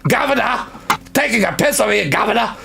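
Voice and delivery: a London geezer telling someone off; mock cockney. Cockney bloke telling a stranger to piss off.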